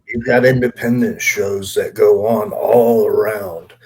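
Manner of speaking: ordinary talk, nothing marked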